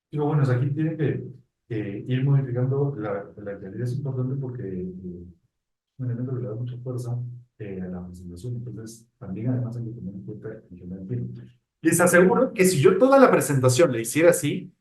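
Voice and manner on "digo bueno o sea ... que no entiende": much softer, fast, off-mic and hard to hear; far from the mic. Shown as a demonstration. Presenter moves off-mic to show the webinar it can't hear him. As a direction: back away from the microphone and keep talking. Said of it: "Pero me voy a alejar un poquito, no me van a entender".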